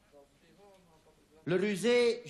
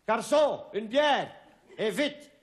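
calling waiter loudly; 6 semitones higher, 4 dB louder than usual. Acted. Jean calls out to waiter.

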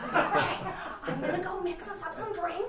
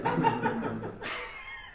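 high giggle. Real, not acted. Laughs at whisper joke.

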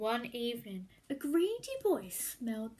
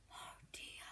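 softer, hushed oh dear; whispered aside; 18 dB quieter than usual. A narrator's reading. Narrator warns the listening children, in a whisper.

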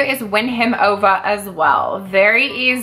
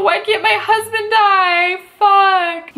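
whiny complaint; 8 semitones higher, slower than usual. Pretend. Whining at the game.

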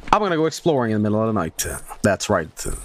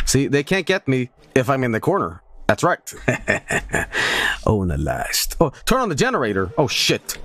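ordinary talk

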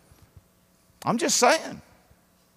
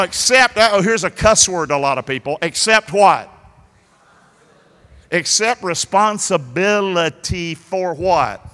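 ordinary talk

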